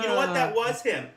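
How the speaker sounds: ordinary talk, nothing marked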